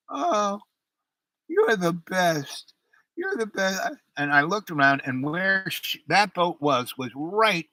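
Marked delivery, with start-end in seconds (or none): 0.1-3.9 put-on lightly raised, slurred drunk gush; drunk wife. Drunk wife in the lifeboat gushing at husband, lightly slurred. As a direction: gush it out sloppily, like she's tipsy. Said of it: "she looked up and she goes".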